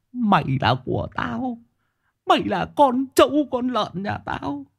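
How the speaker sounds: high, slow, loud villain gloat. Shown as a demonstration. Villain demo.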